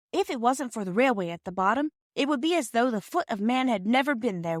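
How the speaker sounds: a hint of a dreamy girl; Phyllis. Phyllis wondering at gorge.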